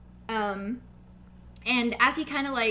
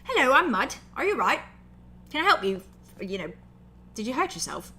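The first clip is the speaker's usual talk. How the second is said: friendly orc Mud; 3 semitones higher, 5 dB louder than usual. Acted. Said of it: "He's just kinda like".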